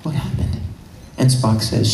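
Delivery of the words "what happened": hushed, almost whispered question; acted shock. Kirk leaning over her to Spock, acted hushed shock. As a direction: ask it softly, with the breath gone.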